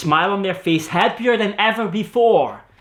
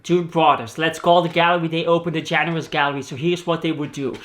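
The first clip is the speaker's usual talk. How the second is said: ordinary talk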